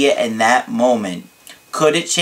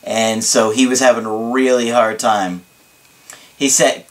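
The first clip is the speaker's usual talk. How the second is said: normal talking voice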